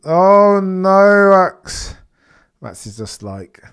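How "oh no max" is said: mock dismay. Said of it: "Max has gone".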